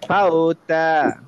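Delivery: "ফাওতা" very slow, glide held; light lin stretch. Shown as a demonstration. Teacher reading for students to follow, "ফাওতা" stretched. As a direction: draw out both syllables of "ফাওতা". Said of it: "একটু হালকা লিন হয়ে যেন উচ্চারণ করি".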